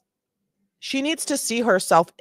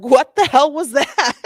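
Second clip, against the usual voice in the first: mock shocked yell; 6 semitones higher, 8 dB louder than usual. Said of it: "she's like".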